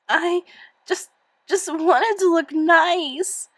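muffled tearful voice. Acted. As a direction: talk into a coat, muffled. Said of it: "voice was muffled by her mother's coat".

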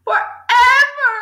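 high, loud, stretched-out wail; distraught girl's drawn-out exclamation; despairing cry. A narrator's reading. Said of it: "Matilda cried".

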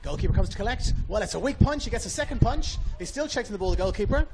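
rising urgency as the scramble unfolds; excited commentary. Real, not acted. Commentator calling a corner scramble to listeners, excited.

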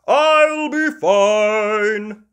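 high, loud, very slow cocky voice; Daddy Pig confident. Acted. Daddy Pig dismissing Mummy's warning, loud and slow. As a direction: brush off the worry. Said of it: "says Daddy Pig".

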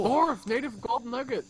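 excited. Real, not acted. Excited find in game.

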